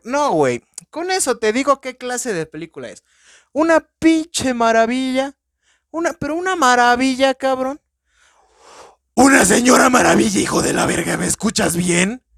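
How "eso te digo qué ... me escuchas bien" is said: excited raving. Real, not acted. Gushing over a favourite film.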